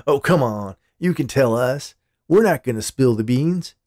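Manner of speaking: acted chummy prodding, pushed up and loud; cajoling. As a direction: wheedle the secret out of a coworker. Coworker coaxes for confidential news.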